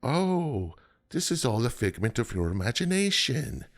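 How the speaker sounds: high, hammed-up mystic; mock cloaked figures. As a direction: voice the hooded strangers, lifted and odd. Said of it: "They're like".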